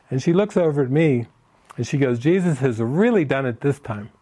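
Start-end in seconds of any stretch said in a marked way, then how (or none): none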